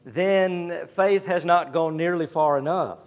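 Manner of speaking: usual speaking voice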